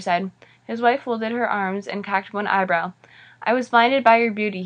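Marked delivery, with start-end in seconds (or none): none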